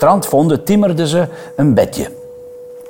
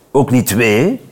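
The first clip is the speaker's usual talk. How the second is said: ordinary delivery, nothing marked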